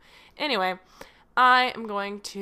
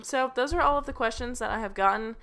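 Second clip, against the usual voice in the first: normal talking voice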